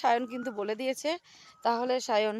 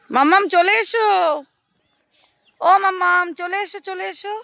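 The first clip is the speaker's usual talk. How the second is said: calling out; 6 semitones higher, 8 dB louder than usual. Real, not acted. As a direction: call her back across the field. Mother calls child to come. (জোরে ডাকা)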